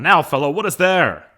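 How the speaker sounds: loud, high theatrical question; hailing a servant. Acted. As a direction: call out to the servant as master. Acting Capulet hailing serving man.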